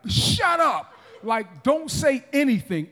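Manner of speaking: high burst of command, then easing; authoritative shout. Acted. Jesus shutting up the demon, authoritative shout. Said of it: "Jesus' authority was like".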